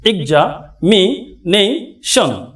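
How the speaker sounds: very slow "examination" split into syllables. Shown as a demonstration. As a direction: count out "examination" one beat at a time. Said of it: "কয়টি syllable পাই একটু দেখব".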